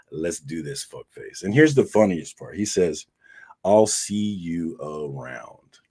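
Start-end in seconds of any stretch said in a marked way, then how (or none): 0.1-1.4 low challenge sinking to a hush; imitating an ominous dare; mock menace. Quoting the same challenge again. 3.6-5.8 very slow, drawn-out veiled threat; imitating a slow, loaded farewell; mock ominous. Quoting friend's veiled threat.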